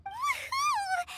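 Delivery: high, gleeful woohoo; excited cheer. Acted. Paimon cheering at the menu, gleeful whoop.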